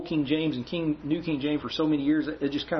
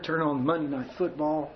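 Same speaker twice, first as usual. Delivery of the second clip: ordinary talk, nothing marked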